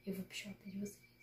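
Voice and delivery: whispered playful threat; mock spooky whisper. Threatening viewers she'll pull their feet, in a spooky whisper. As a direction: hiss it like a ghost under the bed. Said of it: "não tô brincando, é uma ameaça".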